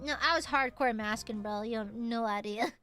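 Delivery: ordinary talk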